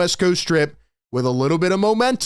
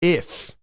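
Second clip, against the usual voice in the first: If, set apart and leaned on; stress on IF. Shown as a demonstration. Radio host stressing the word to callers, If, emphasized. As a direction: lean hard on If before the pause. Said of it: "I will emphasize the word as much as I can".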